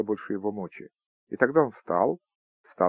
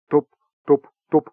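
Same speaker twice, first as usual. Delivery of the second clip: footsteps; 3 semitones higher, 8 dB louder than usual. Acted. Ghost's footsteps on the stairs.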